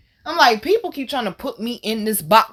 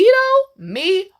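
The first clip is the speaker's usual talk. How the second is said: very high, playful self-pointing question; coy eager questioning; 11 semitones higher, 5 dB louder than usual. Retold or imitated. Diner answering the waiter's order question, playfully eager. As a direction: pitch it way up, relishing the turn to order.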